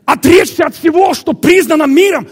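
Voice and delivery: high, full-force preacher's shout; impassioned preaching. Real, not acted. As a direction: shout the demand at the congregation.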